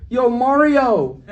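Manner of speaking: high, drawn-out heckling call; mock calling out. Lady heckles comedian across the train, mock calling out. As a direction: stretch it out across a train car.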